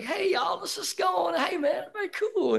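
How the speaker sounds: calm the room, hamming up the sweetness; soothing peacemaker. Retold or imitated. Calming people around an unstable man.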